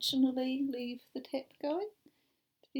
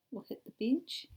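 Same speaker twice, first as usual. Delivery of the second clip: ordinary delivery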